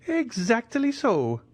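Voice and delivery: high, drawn-out delighted agreement. A narrator's reading. Major confirms who sent him to the Count, delighted. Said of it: "said the delighted major".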